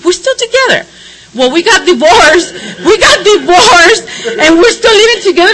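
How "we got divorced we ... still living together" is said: laughing. Real, not acted. Laughing about living with ex.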